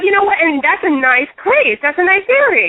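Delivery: a touch of forced optimism, pitch lifted; upbeat thinking. Retold or imitated. Talking herself into moving, lightly upbeat mock optimism. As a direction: talk yourself into it brightly. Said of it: "and I thought".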